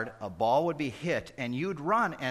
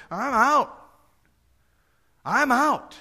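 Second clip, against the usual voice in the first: mock exhausted; 11 semitones higher, 8 dB louder, slower than usual. Tired man splashed with water.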